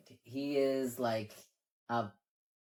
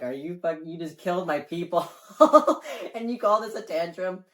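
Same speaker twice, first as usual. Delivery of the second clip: mock outrage, higher and louder, cracking into a laugh; half-laughing fury at an insult; indignant outburst; 4 semitones higher, 8 dB louder than usual. Lorgar's imagined retort to Guilliman, laughing outrage.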